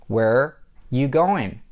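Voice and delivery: slow "Where you going", pause after "Where"; broken-down demo. Shown as a demonstration. Teacher splits the example for learners.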